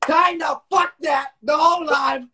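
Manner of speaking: loud high shout; high angry shout. Real, not acted. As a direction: yell it over him. Heated insult argument.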